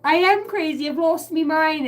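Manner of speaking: high, loud, hammed-up raving; mock madness. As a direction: act comically out of your mind. Mock frantic self-quote.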